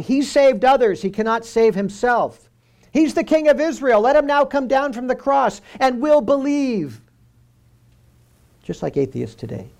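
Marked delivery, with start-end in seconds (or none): 0.0-7.0 higher, louder jeering call; mocking taunt. A narrator's reading. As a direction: sneer it like a taunting crowd at the cross. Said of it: "were mocking him and saying".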